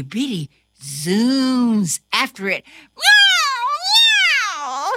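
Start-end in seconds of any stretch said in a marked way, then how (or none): 3.0-4.9 very high, louder meow; excited meow. Acted. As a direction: yowl while chasing. Cat zooms after dog.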